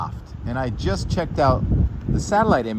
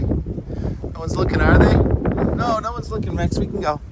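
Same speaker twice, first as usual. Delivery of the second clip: coaxing the dog; 7 semitones higher than usual. Real, not acted. Coaxing shy dog in coat.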